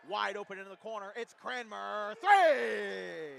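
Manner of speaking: building to a huge yell; excited shout. Real, not acted. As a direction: build up and roar the three. Go-ahead three called.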